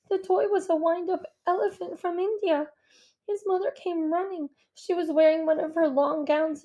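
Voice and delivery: put-on a young boy telling it through shock; shaking and crying. A narrator's reading. Izzy the boy recounting the accident, a hint of trembling. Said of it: "Izzy is shaking and crying as he tells his part".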